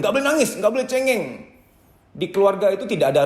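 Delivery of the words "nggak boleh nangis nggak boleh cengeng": scolding parent. Retold or imitated. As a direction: imitate a strict parent telling a kid not to cry. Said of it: "Dari kecil kita didoktrin".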